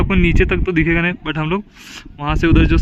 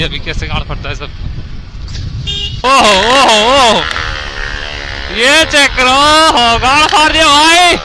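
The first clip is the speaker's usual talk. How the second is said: excited shouting; 3 semitones higher, 7 dB louder than usual. Real, not acted. Bikers racing downhill, shouting excitedly.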